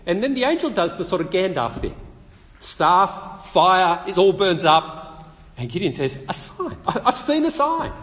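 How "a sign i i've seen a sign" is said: high, breathless mock wonder with a laugh; mock amazed. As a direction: gasp it out like a stunned man. Gideon amazed at the fire.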